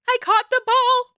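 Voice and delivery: put-on high, slow, squealing little girl; excited child voice. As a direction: shriek it like a thrilled kid. Child voice celebrating.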